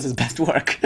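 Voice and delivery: words breaking into a real laugh. Real, not acted. Lecturer amused by Swamiji's other best work, laughing. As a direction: say it through a laugh. Amused by his own contradiction.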